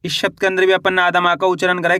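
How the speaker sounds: normal talking voice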